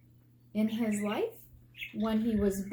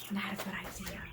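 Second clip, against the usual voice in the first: whispered warning; 3 semitones lower, 9 dB quieter than usual. Real, not acted. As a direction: lean in and whisper it like a secret caution. Teacher's whispered warning aside.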